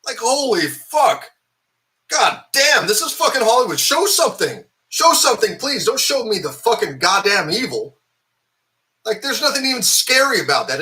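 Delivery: high, loud furious rant at Hollywood; a viewer yelling at the film, outraged and loud; angry rant. Real, not acted. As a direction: rage at the screen, voice cracking up high. Ranting at the movie.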